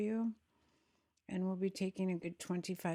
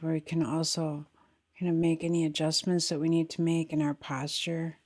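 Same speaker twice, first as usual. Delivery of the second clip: guided meditation; 2 semitones lower, 5 dB louder than usual. Acted. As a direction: guide them gently into the body, unhurried, falling phrase ends.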